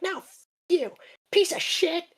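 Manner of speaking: high squeaky mock princess; high-pitched spoiled brat insult; bratty princess cursing. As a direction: spit it out in a tiny whiny voice. Princess insults boss, voiced.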